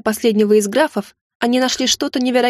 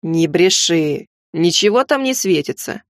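ниже и очень медленно, чётко; грубо отрезать, чеканя слова; грубый незнакомец; 4 semitones lower, slower than usual. Acted. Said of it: "чётко произнёс один из них". (низкий медленный грубый голос)

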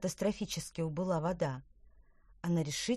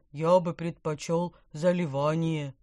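gurgling fish voice; 6 dB louder than usual. Acted. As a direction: bubble the words like a carp. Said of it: "пробулькал карп".

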